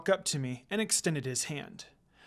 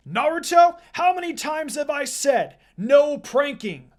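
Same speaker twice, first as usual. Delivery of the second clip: teacher yelling; 8 semitones higher, 8 dB louder than usual. Acted. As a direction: shout the scolding across the room. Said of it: "Sensei yelled".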